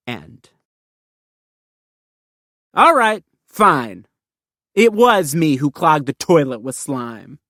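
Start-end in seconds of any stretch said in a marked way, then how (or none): 2.7-4.1 a loud, high-starting huff that drops; reluctant give-in. Acted. Kid finally admitting he clogged the toilet.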